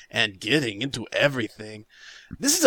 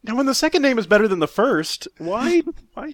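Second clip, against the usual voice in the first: high, loud, disbelieving why; can't believe the name; incredulous; 10 semitones higher, 4 dB louder than usual. Real, not acted.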